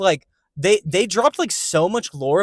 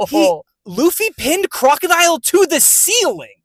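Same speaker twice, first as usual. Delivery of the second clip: excited; 9 semitones higher, slower than usual. Real, not acted. Excitedly retelling the fight scene.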